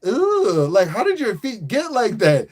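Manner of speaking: high, grossed-out mock voice; disgusted. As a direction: recoil at the sight of their feet. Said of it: "it's just like".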